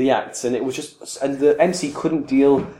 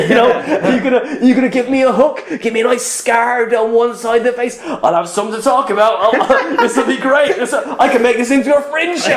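high, laughing retold dare; manic goading; 9 semitones higher, 3 dB louder than usual. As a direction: goad him excitedly, laughter breaking in. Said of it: "but I was manic".

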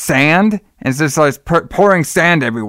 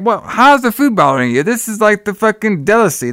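higher-pitched retold protest; mock indignant wife; 8 semitones higher than usual. Wife defends her food to Donald, mock indignation. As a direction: act out an offended cook, lightly.

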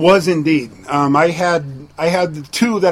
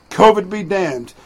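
ordinary talk, nothing marked

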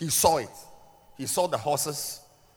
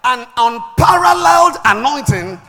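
loud, ringing sermon climax; forceful preaching; 10 semitones higher, 16 dB louder than usual. Acted. Preacher declaring what another father gave Elisha, loud preaching. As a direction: build insistently and land hard on the last word.

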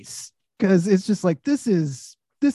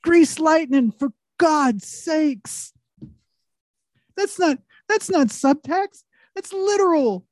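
higher, louder excited rant; 7 semitones higher, 4 dB louder, slower than usual. Real, not acted. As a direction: push it up, indignant and amused. Ranting about Grease subtext.

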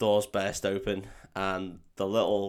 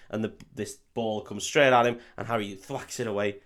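ordinary talk

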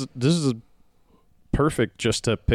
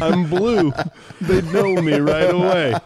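Beast voice; 8 semitones higher, 4 dB louder than usual. Retold or imitated. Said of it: "He's like".

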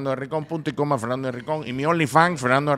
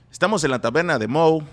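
without Mexican accent; 3 semitones higher, 6 dB louder than usual. Shown as a demonstration. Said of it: "no es lo mismo".

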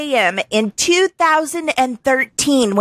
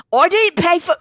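put-on high-pitched stab at an Irish accent, abandoned; bad Irish accent; 7 semitones higher than usual. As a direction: try Irish once more and give up. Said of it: "no, can't do it".

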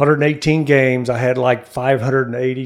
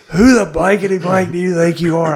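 louder and higher, mock furious; angry manager; 7 semitones higher, 7 dB louder than usual. Manager chewing out the cocky rookie, mock angry. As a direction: bark it like a riled-up skipper.

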